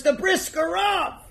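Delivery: high, loud and slow proclamation; loud announcement. Acted. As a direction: declare it for the whole table. Rebbe announces the guest's identity.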